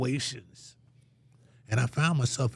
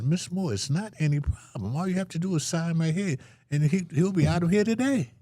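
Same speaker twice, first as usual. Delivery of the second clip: counselor's voice; 8 semitones higher than usual. Retold or imitated. Counselor eager to sign him out.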